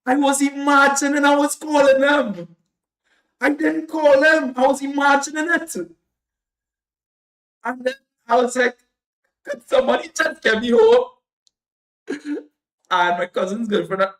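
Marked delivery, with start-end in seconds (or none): 0.1-5.8 high, loud disbelief; stunned that the calling never happened; shocked realization. Real, not acted. 9.4-11.1 high, loud half-sung plea; begging the family to take him home; pleading wail. Retold or imitated.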